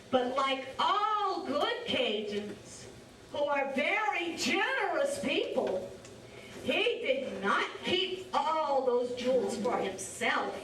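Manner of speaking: ordinary delivery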